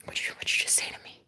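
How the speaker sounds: fast teasing whisper. Shown as a demonstration. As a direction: breathe it out quickly and slyly. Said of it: "whispered again".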